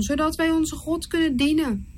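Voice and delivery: a touch of begging; mild pleading. Acted. Aaron pleading with Pharaoh, mildly imploring. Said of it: "smeekte Aaron".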